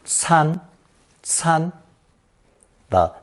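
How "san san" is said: "san" in first tone, held high and level. Shown as a demonstration. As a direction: say "san" on a high, even pitch without rise or fall. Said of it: "also pronounced first tone".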